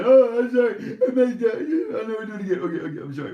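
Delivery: very high, loud fake sobbing apology; mock crying. Host acting out Nancy's tearful apology, mock crying. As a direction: blubber it out in hammed-up remorse. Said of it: "Come out even cry and say".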